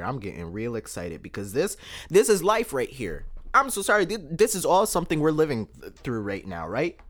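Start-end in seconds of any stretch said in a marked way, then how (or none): none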